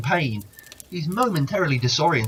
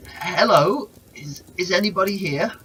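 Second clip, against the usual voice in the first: raised, louder dry croak calling out; 6 semitones higher, 6 dB louder than usual. Acted. Thirsty man calling into the empty place, croaking. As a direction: croak it out hoping someone answers. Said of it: "croaks out".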